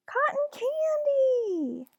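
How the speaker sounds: high, drawn-out squeal of delight; excited toddler cry. Acted. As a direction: shriek it like a thrilled little kid. Toddler excitedly spots cotton candy.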